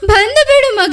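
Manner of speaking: pleading to her son through sobs; mother begging her son to come home, crying; crying plea. Acted. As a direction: beg him to come as the tears break through. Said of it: "ಅಳ್ಬೇಡ, ಸಮಾಧಾನ ಮಾಡ್ಕೊ".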